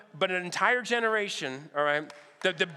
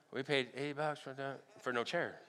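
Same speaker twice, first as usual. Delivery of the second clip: muttered complaint; 4 semitones lower, 10 dB quieter than usual. Retold or imitated. Muttered aside at concert.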